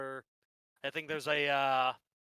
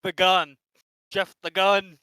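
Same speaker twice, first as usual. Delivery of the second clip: loud call; 5 semitones higher, 13 dB louder than usual. Acted. Shouting Jeff's nickname.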